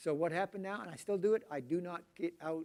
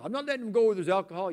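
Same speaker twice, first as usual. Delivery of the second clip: indignant parent; 3 semitones higher, 9 dB louder, faster than usual. Retold or imitated. Parent refusing visit over alcohol.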